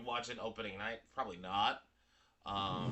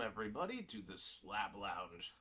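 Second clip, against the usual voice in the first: show intro; 5 semitones lower, 7 dB quieter than usual. Acted. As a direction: stretch the show name like a host. Welcoming viewers to the show.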